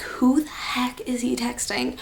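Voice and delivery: hushed, whispered aside; whispered disbelief. Retold or imitated. Girlfriend reacting to his texts, whispered disbelief. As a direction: whisper it in mock shock.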